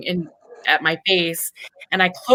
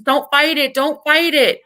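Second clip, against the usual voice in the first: higher, insistent urging; urging nurse voice; 8 semitones higher than usual. Retold or imitated. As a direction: press the patient not to resist. Staff urging gagging patient.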